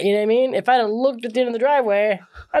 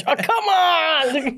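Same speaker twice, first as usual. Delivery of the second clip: drawn-out groan of frustration; 8 semitones higher, much slower than usual. Retold or imitated. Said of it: "I'm like".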